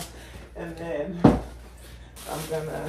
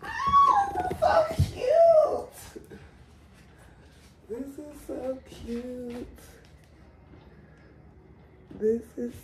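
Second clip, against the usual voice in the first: high, slow, tearful cry; overwhelmed happy tears; crying with joy; 12 semitones higher, slower than usual. Real, not acted. Overcome opening baby bundle.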